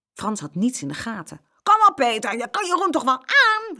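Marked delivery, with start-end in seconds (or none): 1.6-3.8 high, loud goading shout; boy impatiently cheering on his champion; called-out urging. A narrator's reading. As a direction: yell encouragement at the losing fighter. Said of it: "riep hij".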